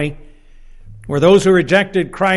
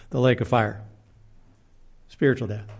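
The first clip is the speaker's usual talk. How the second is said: usual speaking voice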